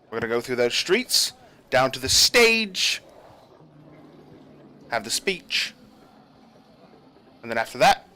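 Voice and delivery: brisk briefing from the captain; stern general. Acted. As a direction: command the guards firmly. GM voices general briefing guards.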